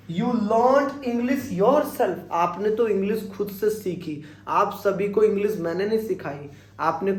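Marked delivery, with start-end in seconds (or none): 0.1-2.1 very slow, word by word You learned English yourself; slow model sentence. Shown as a demonstration. Teacher slowly modelling You learned English yourself. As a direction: dictate it clearly to students. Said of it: "तो देखो मैं क्या बोलूंगा".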